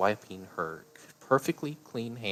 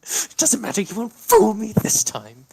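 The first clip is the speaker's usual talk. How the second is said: even higher loud scream; furious shriek; 12 dB louder than usual. Acted. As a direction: snap into hysteria. Repeated line climbs to shriek.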